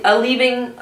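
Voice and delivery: usual speaking voice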